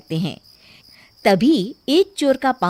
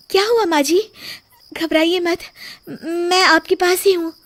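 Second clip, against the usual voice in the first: put-on higher-pitched reassuring young wife; concerned daughter-in-law; 8 semitones higher than usual. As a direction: soothe your frightened mother-in-law in a high voice.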